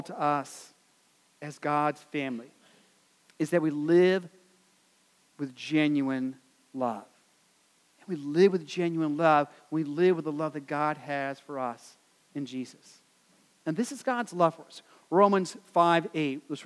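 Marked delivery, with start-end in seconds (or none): none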